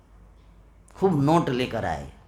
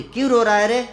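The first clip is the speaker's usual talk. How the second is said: a mother scolding her son, raised and loud; mother's sharp, loud rebuke; 7 semitones higher, 6 dB louder than usual. Retold or imitated. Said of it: "माँ कहती है". (माँ की ऊँची डाँट)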